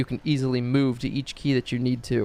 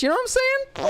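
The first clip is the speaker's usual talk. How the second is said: squeaky aside. Acted.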